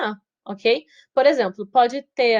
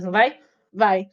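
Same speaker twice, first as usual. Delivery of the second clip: ordinary delivery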